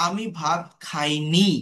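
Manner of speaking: usual speaking voice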